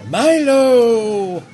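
slow, grand talking badger; booming badger statue. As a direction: proclaim it like an ancient beast. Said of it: "It says".

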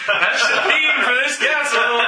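laughing. Real, not acted.

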